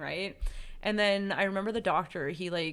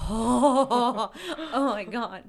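louder, laughing through tears; relieved laughing sob; 5 semitones higher, 7 dB louder, much slower than usual. Retold or imitated. Hearing she doesn't have HIV, laughing with relief. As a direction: gush with relief.

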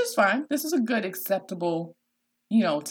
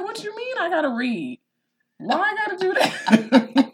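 high-pitched mock kid whine; complaining child; 11 semitones higher, 5 dB louder than usual. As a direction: imitate a six-year-old protesting homework. Mimics six-year-old balking at schoolwork.

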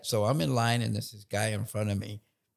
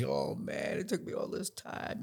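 mock crying; 9 semitones lower, 7 dB quieter than usual. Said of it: "So the guy's crying. He's".

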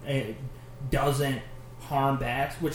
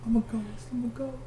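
mock ghost whisper; 8 semitones higher, 5 dB quieter than usual. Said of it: "just whispers in your ear".

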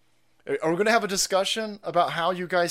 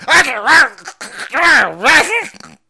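much higher, louder squealing mock voice; mocking goblin reply; 11 semitones higher, 14 dB louder, slower than usual. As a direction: squeal it back in a silly high voice. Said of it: "the hot teacher's like".

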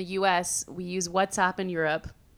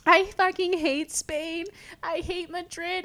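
high, drawn-out tearful whine; imitated crying; 9 semitones higher, slower than usual. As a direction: overdramatic wail, as if crying. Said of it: "I was, like, crying".

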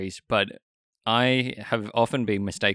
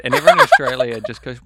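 ordinary talk, nothing marked

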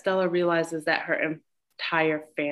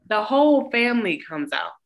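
normal talking voice

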